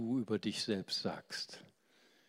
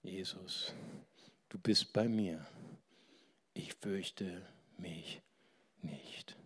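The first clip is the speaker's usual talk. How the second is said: slow, hushed whisper; whisper to himself; 4 semitones lower, 6 dB quieter, slower than usual. Retold or imitated. Patient praying to himself before surgery, whispered. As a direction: pray it quietly to yourself. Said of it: "Und ich hab zu mir gesprochen".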